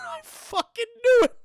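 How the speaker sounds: high, gleeful crow through laughter; giddy vindication, cracking up; triumphant laughing. Real, not acted. Triumphant laughing at own theory.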